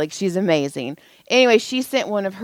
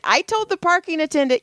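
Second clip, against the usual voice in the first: normal talking voice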